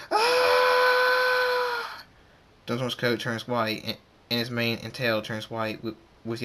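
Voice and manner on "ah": long, loud, very high scream; acted scream. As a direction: scream at the top of the range and hold it. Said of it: "Thunderstorm screams".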